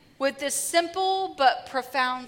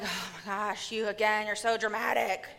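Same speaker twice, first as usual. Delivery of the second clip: a quiet, lightly voiced eye-roll; mock exasperated; 5 semitones lower, 7 dB quieter than usual. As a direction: sigh it out, fed up but understated. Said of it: "he's not like".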